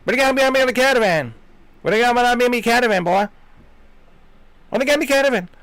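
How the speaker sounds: fast, high, loud Pikey gabble; Pikey accent. Acted. Pikey from Snatch talking caravans, unintelligible accent. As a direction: rattle it off in mock Pikey accent. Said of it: "like that movie Snatch, when the Pikeys".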